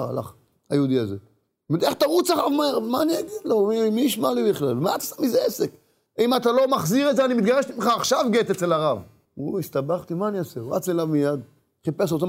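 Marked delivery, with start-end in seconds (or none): 1.8-5.7 high-pitched quarrelling voice; agitated protest. Retold or imitated. Married couple quarrelling over the sold afterlife, acted high agitation. 6.2-9.0 high, forceful acted threat; angry ultimatum. Wife threatening husband with divorce, acted high ultimatum.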